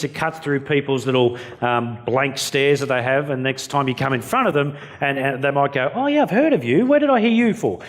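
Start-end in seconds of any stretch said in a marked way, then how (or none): 5.9-7.8 high, bright-eyed "oh yeah, I know you" voice; mock recognition. A prospect suddenly recognizing the speaker, high mock surprise. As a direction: play a stranger suddenly placing a face. Imagined audience member recognizing him.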